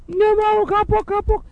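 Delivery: put-on high-pitched childish protest; mock distressed child. Injured child answering mother, high mock whine. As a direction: imitate a kid who has learned the hard way. Hurt child voiced in story.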